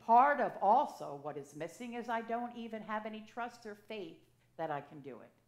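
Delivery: ordinary talk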